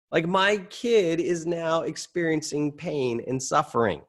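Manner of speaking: ordinary talk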